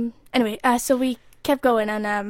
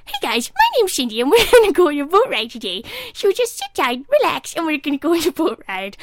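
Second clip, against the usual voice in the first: high, bright tour guide chirp, much higher and louder; Cindy voice; 6 semitones higher, 6 dB louder than usual. Retold or imitated. Doing the boat guide Cindy for the podcast, hammed-up chirpy. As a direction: play a chirpy theme park boat guide, pitched way up. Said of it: "should I do the voice of Cindy".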